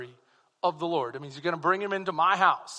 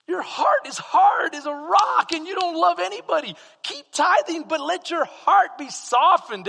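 loud and high, a full preaching shout; preached rebuke; 10 semitones higher, 7 dB louder than usual. Acted. Jesus rebuking the Pharisees, a loud high shout. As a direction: thunder it at the hard-hearted.

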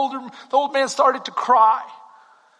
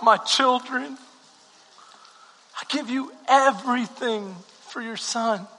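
hushed prayer; 3 semitones lower, 5 dB quieter than usual. Acted. Pastor praying over the congregation, soft and low. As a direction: pray quietly over the church.

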